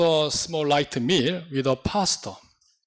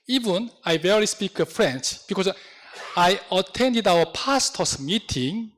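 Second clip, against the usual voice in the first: ordinary talk, nothing marked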